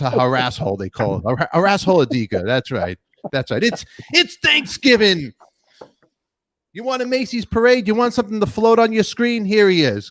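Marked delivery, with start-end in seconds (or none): 3.6-10.1 hyped. Acted. Hyping a Macy's parade float.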